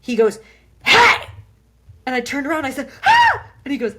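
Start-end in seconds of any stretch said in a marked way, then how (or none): 0.8-1.3 high, loud mock bark; sudden imitated gotcha yell; startling shout. Passenger calls out to startle her. 3.0-3.5 short, very high acted shriek; loud mock yelp of fright; startled scream. Acts out being startled.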